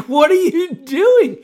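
high, loud, laughing disbelief; a guard cracking up at the sight. Retold or imitated. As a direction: laugh out the question, stunned. Guards react to the offered head.

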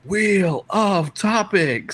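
high, slow Wheel of Topics announcement; drawn-out announcer voice. Retold or imitated. Radio host imitating the show jingle, slow and drawn out. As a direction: stretch it out like a game show host. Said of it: "It's like".

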